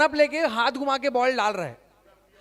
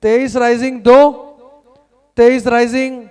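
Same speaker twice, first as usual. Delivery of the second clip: rising bid calls, louder; auctioneer calling bids; 7 dB louder, slower than usual. Acted. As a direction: call out each bid like an auctioneer.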